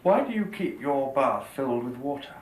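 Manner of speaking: ordinary delivery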